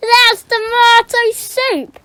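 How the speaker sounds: loud, slow mocking put-down. Caretaker to the boy, loud slow mockery. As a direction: rub it in slowly.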